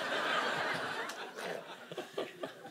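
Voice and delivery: high breathy laugh; real giggle after the punchline; giggling. Real, not acted. Laughing at own joke.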